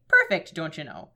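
low Minnesotan with a punched start; Fargo-style Minnesota sign-off; Minnesota accent. Acted. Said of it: "supposed to be doing a Minnesota voice".

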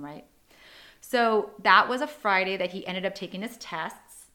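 ordinary delivery

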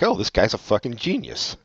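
normal talking voice

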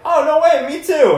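a star voiced as thrilled to see a fan; squeaky over-the-top celebrity delight; excited rock star. [name] answering a fan.

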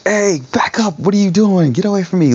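high-pitched mock confrontation; mock aggressive. Co-host acting out the hoped-for reaction to being confronted, mock aggressive. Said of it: "like, an aggressive".

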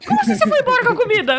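high, fast and laughing, mock outraged; imitating an outraged Irishman, laughing; laughing disbelief. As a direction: blurt it out through laughter in disbelief. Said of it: "Aí o irlandês ficou tipo".

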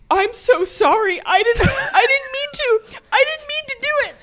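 imitating the husband's panicked apology; upset husband. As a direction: squeak out a mock-distraught sorry. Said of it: "And he was so upset".

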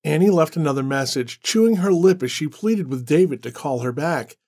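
usual speaking voice

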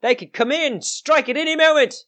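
high-pitched alarmed warning; crabby old gatekeeper. Acted. Gatekeeper warning that goblins could strike, crabby high voice. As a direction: warn them in a high crabby voice. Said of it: "this crabby old lady".

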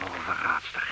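whispered accusation, soft and bitter. Acted. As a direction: breathe the word at her with scorn. Bitter remark at the duchess.

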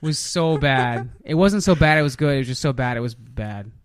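ordinary talk, nothing marked

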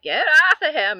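high, loud mock shout; acted angry shout. Nurse yelling at Ratched holding the priest, angry shout. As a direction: shout them off, imitating the nurse. Nurse orders someone off patient.

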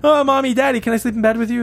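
put-on high, quick pleading child; frightened little boy. Little boy at parents' bed after nightmare, pleading child. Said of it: "has a nightmare".

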